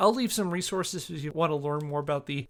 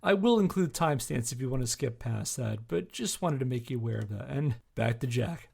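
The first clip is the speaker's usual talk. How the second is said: usual speaking voice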